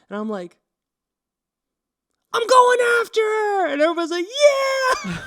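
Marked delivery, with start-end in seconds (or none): 2.3-3.7 big high shout to the whole class; rally the room like a hero; heroic shout. Retold or imitated. Class clown on his desk announces to classmates, loud heroic shout. 4.3-5.2 high, loud laughing whoop; crowd cheer. Retold or imitated. Classmates cheering the class clown on, laughing whoop.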